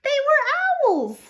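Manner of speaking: story reader's big delighted reveal; excited reveal. A narrator's reading. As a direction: announce the surprise with wonder.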